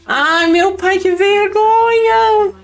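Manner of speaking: high, loud, slow wail of embarrassment; loud, high cringe cry, stretched slow; cringing wail. Real, not acted.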